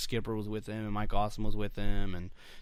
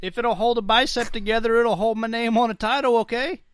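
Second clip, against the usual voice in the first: higher, louder gruff wrestler voice; Sabu voice; 9 semitones higher, 7 dB louder than usual. Retold or imitated. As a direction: shout it like a hardcore wrestler. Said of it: "that's your Sabu voice".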